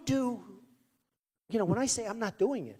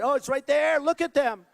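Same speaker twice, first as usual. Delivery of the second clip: excited; 7 semitones higher, 6 dB louder than usual. Retold or imitated. Woman spots his book.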